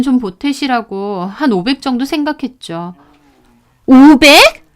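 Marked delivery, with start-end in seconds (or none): 3.9-4.7 higher, louder, indignant shout; sister-in-law's angry outburst. Acted. Sister-in-law scoffing at the gift money, angry shout. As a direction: explode at the meager offer. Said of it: "벌컥 화를 내는 겁니다".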